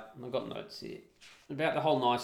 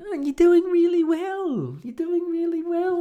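high mock voice of the encouraging wife; imitating wife; 7 dB louder, slower than usual. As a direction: parody her sweet reassurance, pitched way up. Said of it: "my wife's going to me".

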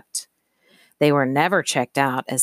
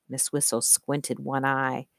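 usual speaking voice

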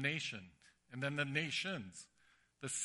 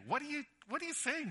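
put-on indignant boy; 13 semitones higher, faster than usual. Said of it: "a little mad at Andrew".

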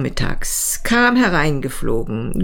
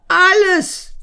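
much higher desperate cry; despairing outburst; 13 semitones higher than usual. Acted. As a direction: blurt it out in despair. Paul confesses everything, despairing.

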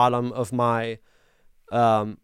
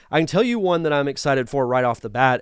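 usual speaking voice

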